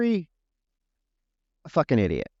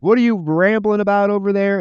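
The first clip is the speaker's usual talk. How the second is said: mock gruff Vincent; 7 semitones higher, 5 dB louder than usual. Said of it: "Vincent's like".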